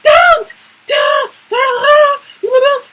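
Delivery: very high mock shriek, repeated; imitated yelling. Vice principal yelling at fighting girls, shrill mock yell. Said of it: "She yelled at us".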